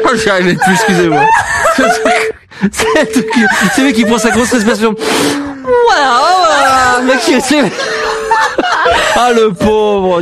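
high-pitched helpless laughter through the words; barely getting words out through laughter; laughing fit. Real, not acted. Host bursts out laughing after call.

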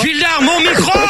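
appel excédé, voix qui monte; réclame le micro d'une voix haut perchée; interpellation agacée. Real, not acted. Animateur réclame son micro, excédé. (rappel à l'ordre exaspéré)

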